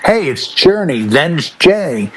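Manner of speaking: usual speaking voice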